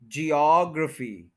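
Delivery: Geography as jee-AWG-ruh-fee, slowly; second-syllable stress. Shown as a demonstration. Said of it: "ओ जी पे stress देना".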